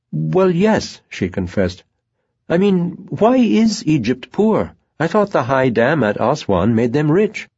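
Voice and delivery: put-on high, hesitant young woman; a timid young woman confessing doubts; Natasha. A narrator's reading. Young woman voiced by narrator.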